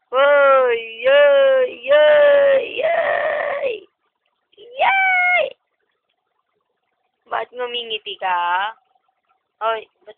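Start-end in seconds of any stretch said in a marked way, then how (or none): 0.1-5.5 loud shouts climbing much higher; loud rising playful shouts. Real, not acted.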